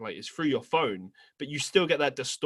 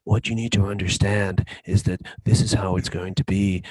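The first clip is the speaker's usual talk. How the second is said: Leafy's close-mic voice; 4 semitones lower, 6 dB louder than usual. Retold or imitated. Cohost imitates Leafy's loud close-mic voice to his friend. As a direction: drone right into the listener's ear. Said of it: "his voice would be like this".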